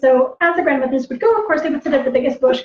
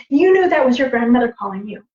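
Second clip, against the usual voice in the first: usual speaking voice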